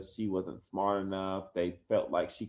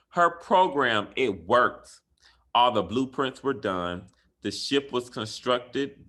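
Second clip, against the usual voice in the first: put-on lively, louder read-aloud for kids; reading a story aloud; 3 semitones higher, 8 dB louder, slower than usual. Teacher reading a bedtime story to her class, lively and bright. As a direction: bring the rhyming picture book to life.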